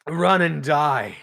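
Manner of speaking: loud battle ultimatum, second half slowed; commanding yell. Acted. Player's character to fleeing guards, commanding yell. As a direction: yell it out as a threat across the battlefield. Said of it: "I'm gonna yell".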